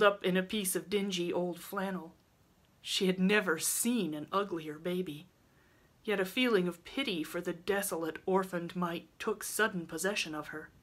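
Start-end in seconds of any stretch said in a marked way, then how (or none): none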